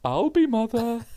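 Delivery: hammed-up uncle offering to serve; mock uncle. Mimicking the uncle at Christmas dinner, hammed-up high voice. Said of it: "it always sounds a bit weird".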